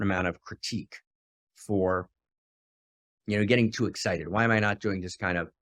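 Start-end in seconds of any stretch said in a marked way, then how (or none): none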